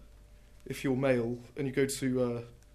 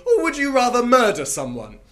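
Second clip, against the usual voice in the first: higher, louder sarcastic jab; taunting; 10 semitones higher, 12 dB louder than usual. Acted. Poet taunting the murderer over sandwiches, loud jab. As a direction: land the jab with glee.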